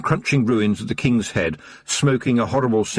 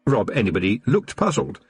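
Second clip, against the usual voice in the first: ordinary delivery, nothing marked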